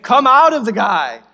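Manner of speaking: Jesus shouting the spirit out; forceful command. Acted. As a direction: bark it at the demon.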